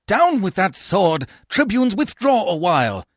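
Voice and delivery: high, loud intervention; urgent command. A narrator's reading. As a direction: call to stop a sword fight. Calming a sword fight.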